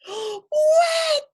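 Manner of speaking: very high loud shriek; wedding guests scream in shock; cried out. A narrator's reading. Wedding guests shriek at the dripping dog. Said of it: "cried the people".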